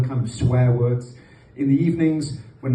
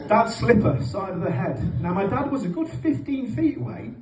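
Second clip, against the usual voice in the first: usual speaking voice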